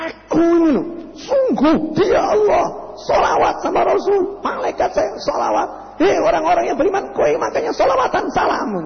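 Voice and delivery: high-pitched, forceful divine proclamation; firm command. Acted. Allah commands the believers to send sholawat, firm high-pitched command. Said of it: "Ini perintah tegas atau lembek?".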